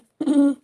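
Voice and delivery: a pointed clear of the throat to get attention; ahem, loud and deliberate. Retold or imitated. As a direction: clear your throat loudly, like nudging someone awake. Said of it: "इस तरह आवाज़ निकालती है".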